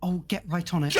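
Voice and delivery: eager to please the shouting chef; flustered servant. Acted. Servant answering angry chef.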